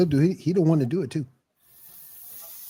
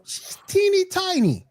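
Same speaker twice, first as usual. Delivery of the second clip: high squeaky mock; slower than usual. Mocking tiny size.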